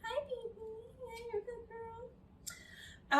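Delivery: put-on high, soft, slow baby talk; cooing to a dog. Greeting a good girl dog, high soft coo. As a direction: coo like greeting a sweet puppy.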